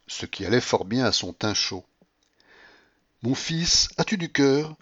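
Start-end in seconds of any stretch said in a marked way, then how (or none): 3.2-4.7 higher, gleeful cry of a flirt; mock-heroic teasing cry; laughing exclamation. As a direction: call out to him, laughing. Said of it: "s'écria-t-elle ... en riant".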